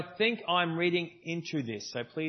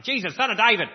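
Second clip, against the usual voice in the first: loud, higher-pitched cry for help; desperate shout; 7 semitones higher, 11 dB louder than usual. Retold or imitated. Blind man shouting to Jesus from the roadside, loud and desperate. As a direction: yell it across the crowd like a blind beggar. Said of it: "he's yelling and yelling and yelling".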